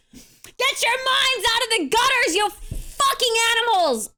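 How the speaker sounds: high, loud outburst at the crowd; shouted scolding. Real, not acted. Streamer scolding dirty-minded chat, high loud outburst. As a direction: yell it high, telling them all off.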